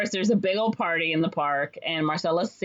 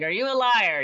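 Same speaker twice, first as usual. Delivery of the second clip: higher-pitched accusing question; mock accusation; 5 semitones higher, 4 dB louder than usual. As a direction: fire it like an upset child's interrogation. Upset boy interrogating the con man.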